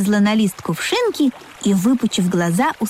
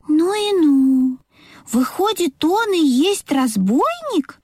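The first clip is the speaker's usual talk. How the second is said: high-pitched astonished little engine; surprised; 6 semitones higher, slower than usual. A narrator's reading. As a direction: marvel slowly in a high voice. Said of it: "удивился паровозик".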